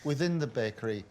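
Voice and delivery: ordinary talk, nothing marked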